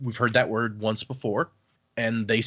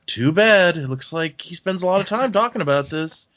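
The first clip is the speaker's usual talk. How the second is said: over-the-top mock sarcasm, pitched high; put-on teenage sarcasm in a raised voice; Vin; 5 semitones higher than usual. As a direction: lay on exaggerated, sarcastic disappointment. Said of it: "Vin does her over-the-top sarcastic".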